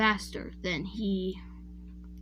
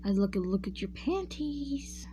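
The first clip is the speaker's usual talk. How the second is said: soft, slow pervert voice; hammed-up lecherous murmur, stretching the last word; Master Roshi; 6 dB quieter, slower than usual. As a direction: leer it slowly, hushed and creepy. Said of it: "his pervert self".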